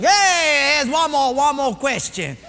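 high-pitched, emphatic examiner; acted personnel man, insistent; brash quizmaster. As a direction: put the question like a brash game host. Personnel guy announces next question.

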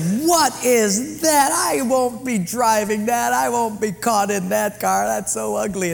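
mock wife's outcry. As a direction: play the horrified wife refusing the car. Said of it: "my wife said".

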